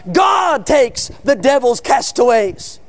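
slow, ringing cry from the pulpit; thundering preacher. Retold or imitated. Whitefield's sermon reaching women outside, thundering proclamation. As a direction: thunder it out, stretching each word. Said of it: "Whitefield was thundering out".

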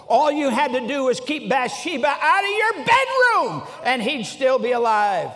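rising, exasperated preaching rebuke; scolding outburst. Retold or imitated. Preacher rebuking David over Bathsheba, scolding. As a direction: scold with mounting force on the last words.

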